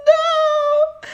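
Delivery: high, loud sung wail; dismayed cry. Real, not acted. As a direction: wail at the mishap. Headphones knock hat off.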